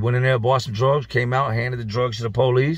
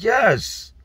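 high yes; 10 semitones higher than usual. Retold or imitated. Friend confirms a shocking claim.

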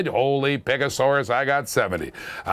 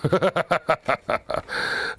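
long staccato laugh. Real, not acted. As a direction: laugh it out in a long run of short bursts. Host laughs after losing sale.

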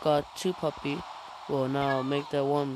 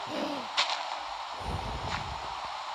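startled gasp; 10 semitones higher, 7 dB quieter than usual. Real, not acted. Something startling happens in game.

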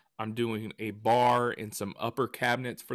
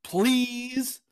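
high, snooty mock voice; mocking the stranger; 12 semitones higher, 5 dB louder than usual. Mocking the stranger who scolded him about his dog, snooty high. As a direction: sneer it like a prissy scold. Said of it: "And he goes".

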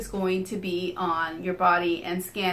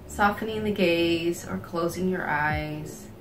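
guided meditation. Real, not acted. Leads a body scan meditation.

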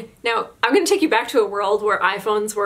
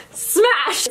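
mock smash; 8 semitones higher, 3 dB louder than usual. Narrating the phone smash.

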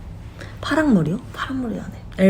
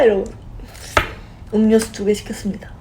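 usual speaking voice

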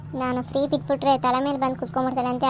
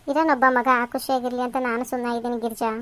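ordinary delivery

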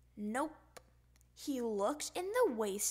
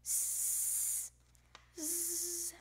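soft snoring zzz; 5 semitones higher, 6 dB quieter than usual. Acted. Snoring sound effect.

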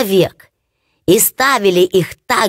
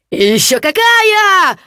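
much higher, louder glee; gleeful boast; 10 semitones higher, 4 dB louder than usual. Acted. Hans praising the ditch mud to his brothers, gleeful. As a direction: brag about the mud like treasure.

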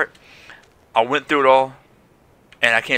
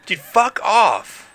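drawn-out fuck off; 8 semitones higher, much slower than usual. Real, not acted. Annoyed at game enemy.

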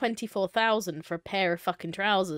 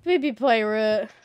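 cooing; 2 semitones higher, 8 dB louder, slower than usual. Pretend. Repeating friend's cute phrase.

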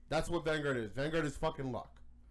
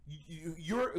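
normal talking voice

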